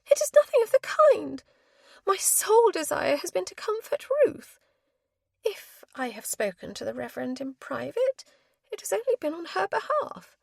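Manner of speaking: high, loud indignant spinster; indignant protest. A narrator's reading. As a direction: defend yourself, stung. Spinster indignant, defending herself.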